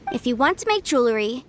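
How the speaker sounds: ordinary talk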